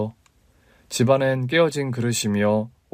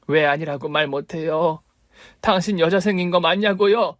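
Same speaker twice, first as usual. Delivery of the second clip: higher, pressing accusation; accusing wife; 6 semitones higher than usual. Acted. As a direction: demand the truth about the affair. Wife confronting affair. (남편의 외도를 따지는 아내의 높은 목소리)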